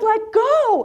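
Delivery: high-pitched mock yell; retold yell. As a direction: holler it back as the instructor. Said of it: "And she yells".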